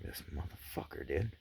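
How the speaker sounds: hissed under the breath; whispered curse. Retold or imitated. Friend hangs up, he whispers a furious curse. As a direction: whisper it in disbelief at the hung-up call.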